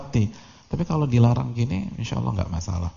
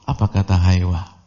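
ordinary delivery

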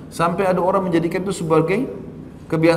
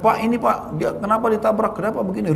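mildly agitated complaint; 7 semitones higher than usual. Retold or imitated. Stranger confronts the driver after a crash, mildly agitated. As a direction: imitate someone making a fuss, lightly.

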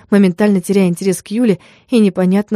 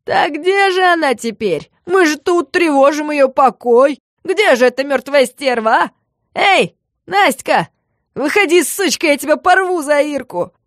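much higher, slow taunting shout; hysterical yelling; 7 semitones higher than usual. A narrator's reading. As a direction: scream taunts at the ghost. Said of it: "особенно громко и истерично".

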